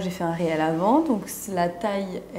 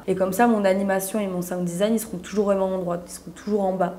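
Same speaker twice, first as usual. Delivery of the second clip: normal talking voice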